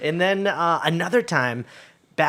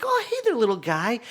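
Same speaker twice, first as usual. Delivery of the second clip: cooing at a squirrel; 8 semitones higher than usual. Retold or imitated. Talking sweetly to baby squirrel.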